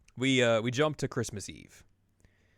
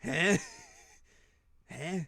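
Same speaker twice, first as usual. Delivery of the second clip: shocked huh; 8 semitones higher than usual. Retold or imitated. Rise told to go shopping, stunned. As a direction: caught off guard.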